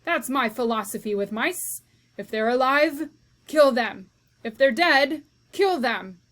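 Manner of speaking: loud, hammering each kill them; emphatic shouting. Acted. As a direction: preach it fiercely. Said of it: "shouted Cook".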